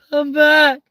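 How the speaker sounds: very high, loud call; high shouted call back. Pretend. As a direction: shout it after them, falling. Calling after someone leaving.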